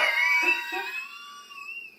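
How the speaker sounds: very high, squealing laugh; shrieking laugh. Real, not acted. Cracking up at a friend's suggestion, shrieking laugh. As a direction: screech with laughter.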